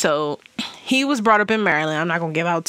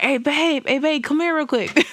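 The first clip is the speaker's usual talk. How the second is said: put-on boyfriend calling out; 8 semitones higher than usual. Boyfriend calling her over.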